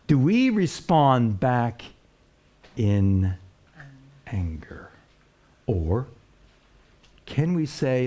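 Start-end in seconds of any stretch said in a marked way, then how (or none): 4.3-4.9 dropped to a hushed whisper. Acted. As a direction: breathe the word anger. Preacher drops to whisper for effect.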